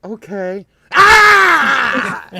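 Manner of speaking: high, loud scream after a meek okay; mimicking a daughter startled by a prank; imitated scream. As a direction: jump and shriek. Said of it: "she'd be like".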